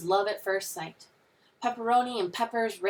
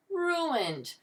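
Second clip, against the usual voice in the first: high wail sliding down; high, despairing exclamation; crying out in dismay; 9 semitones higher than usual. A narrator's reading. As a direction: wail it like a disaster. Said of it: "Mister Morley cried".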